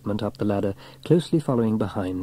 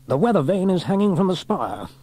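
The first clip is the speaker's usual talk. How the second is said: higher-pitched commanding station officer; Station Officer Steele; 8 semitones higher, 3 dB louder than usual. A narrator's reading. Station officer assessing the damaged weather vane, in command. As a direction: give it an officer's crisp authority.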